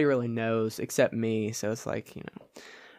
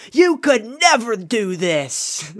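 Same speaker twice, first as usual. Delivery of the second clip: slow, high jeering heckle; heckler; 8 semitones higher, 5 dB louder, slower than usual. Pretend. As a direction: taunt them like a heckler. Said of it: "someone starts heckling you".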